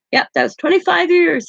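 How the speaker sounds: a lightly voiced, smug old man; imitating grandpa.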